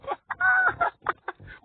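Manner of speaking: high shouted laugh; hammed-up laugh. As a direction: cackle it out loud from on high. Heavenly voices laughing.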